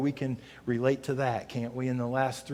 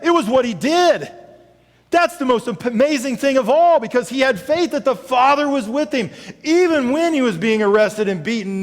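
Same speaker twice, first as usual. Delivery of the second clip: louder, higher preaching to the congregation; full-force preaching; 10 semitones higher, 11 dB louder than usual. Acted. Pastor rallying congregation to serve, preaching hard. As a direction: pastor urging the church to go serve.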